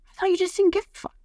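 high, teasing boyfriend impression; mock boyfriend voice. Boyfriend admits he thought she didn't care, mimicked high. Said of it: "He's like".

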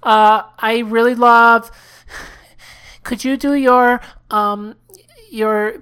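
put-on awkward caller voice, slow and monotone; hesitant caller. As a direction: stumble through a first call to a radio show.

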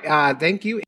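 ordinary talk

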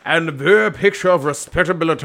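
quick, hammed-up clergyman accent; Doctor Chasuble's character voice. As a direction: rattle it off as a comic reverend. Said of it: "this is the character".

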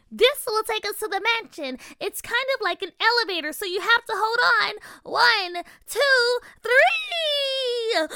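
high fairy queen counting up; fairy voice building to a cry; cold regal fairy queen. A narrator's reading.

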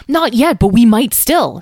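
high, loud, eager rebuttal; excited. A narrator's reading. Tom sees hope of freeing the dragon, excited. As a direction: jump in with rising hope. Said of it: "said Tom excitedly".

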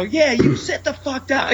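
high, slow mock yell; retold yelling. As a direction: draw out a high, half-volume yell at the audience. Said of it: "I'm yelling at them".

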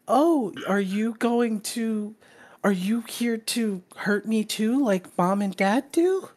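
put-on high, hushed child voice; little kid. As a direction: ask slowly, like a small child afraid of being hurt. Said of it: "the little kid goes".

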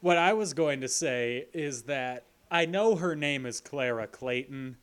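ordinary talk, nothing marked

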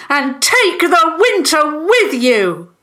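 high, loud banishing shout; yelled command. A narrator's reading. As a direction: yell after the retreating enemy. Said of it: "the Fentons yelled".

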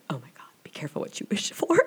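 fast, breathless whisper; awed whisper. Retold or imitated. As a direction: whisper it to yourself in disbelief. Shocked aside to herself.

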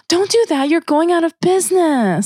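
high-pitched mimicked fretting; put-on high voice of a meddling customer; mock alarm. Retold customer's interjection, acted out.

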